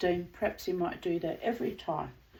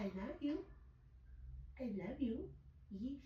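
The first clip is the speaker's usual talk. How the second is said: tender whisper; 2 semitones higher, 14 dB quieter than usual. Real, not acted. Tender goodnight to bird.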